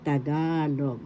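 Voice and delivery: long stressed GA in "Tagalog"; stress on second syllable. Shown as a demonstration. Teacher confirming correct pronunciation, GA stressed in "Tagalog". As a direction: lean on the middle GA of "Tagalog". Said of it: "That's how you pronounce it correctly".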